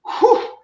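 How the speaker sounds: high unvoiced relieved blow-out; relieved exhale, mock-dramatic; breathy whew of relief. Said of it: "I was like".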